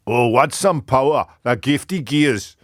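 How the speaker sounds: bigger second stab at a Scottish accent. Shown as a demonstration. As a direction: declaim Burns in a put-on Scottish accent, slowing at the end. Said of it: "I'll try again".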